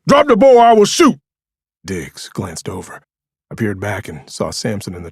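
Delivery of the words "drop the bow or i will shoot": loud commanding shout, much higher; yell. A narrator's reading. John shouting a warning at Diggs, loud command. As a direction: bark the police warning across the trail. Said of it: "I heard John yell behind me".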